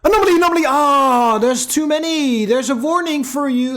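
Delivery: high, loud, sing-song mock of the game's warning; shrill parody of a nagging alarm. Gamer mocking the game's warning, high and loud.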